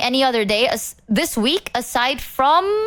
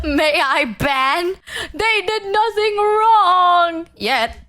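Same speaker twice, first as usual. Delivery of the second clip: slow, higher, fake-crying whine; mock whining; 6 semitones higher, much slower than usual. Streamer pleading in mock tears about banning a chatter. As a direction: whimper it like a pretend sob.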